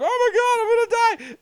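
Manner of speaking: shrieking way up high in hammed-up panic; mock panic. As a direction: squeal like you're about to die. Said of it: "going freaking out".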